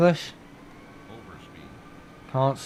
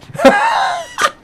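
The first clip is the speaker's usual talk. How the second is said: high squealing laugh; shrieking laugh; 4 dB louder than usual. Real, not acted. Streamer cracking up at his own joke, high laugh. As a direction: burst into a high cackle.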